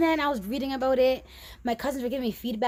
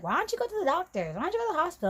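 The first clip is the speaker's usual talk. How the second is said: high, quick nagging questions; retold friend's urging, pitched up; imitating best friend; 7 semitones higher, much faster than usual. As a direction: urge it high and fast as the friend. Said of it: "she's like".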